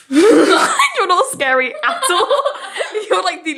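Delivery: high, giggling through the words; laughing teasing. Real, not acted. Friend telling Natasha she isn't scary, laughing teasing. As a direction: tease helplessly through real laughter.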